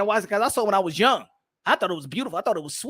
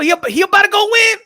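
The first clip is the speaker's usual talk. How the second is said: ordinary delivery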